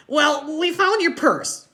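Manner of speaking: put-on high, loud kid blurt; sudden loud high-pitched outburst; Freak blurting out. A narrator's reading. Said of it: "Freak blurts out".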